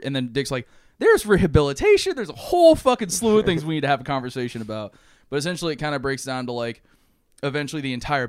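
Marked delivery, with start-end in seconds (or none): 1.0-4.9 loud, high-pitched outburst, easing slightly toward the end; exasperated argument. Acted. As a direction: argue back in acted frustration, voice raised. Acted argument against killing criminals.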